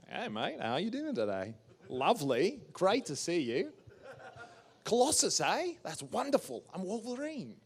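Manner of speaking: put-on high, cheery Australian accent, laughing; Hugh Jackman impression. Imitating Hugh Jackman's greeting.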